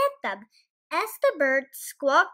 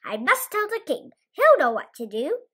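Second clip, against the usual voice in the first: louder, higher urgent resolve; 4 semitones higher, 8 dB louder than usual. Acted. Chicken Little resolving to warn the king, urgent. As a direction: push it out in a hurry.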